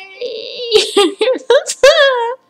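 high giggle trailing down; high falling laugh. Real, not acted. Gamer laughing at his own silly singing. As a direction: let a high laugh tumble down.

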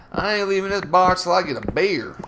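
old country guy at the bar, hammed-up Southern accent; bad Southern accent. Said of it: "that was a really bad accent".

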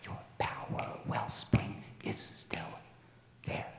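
soft refrain fading into a whisper. Acted. Father urging pup to keep hope, fading to a whisper. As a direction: let it die away to a breath.

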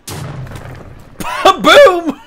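big, high "ba-boom" blast; booming mock gunshot; shouted explosion noise. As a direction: yell it like a gun going off. Voices the gun's blast.

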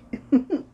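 laugh. Real, not acted. Laughs at funny name.

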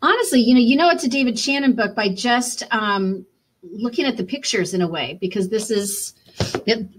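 usual speaking voice

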